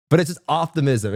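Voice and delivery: ordinary talk, nothing marked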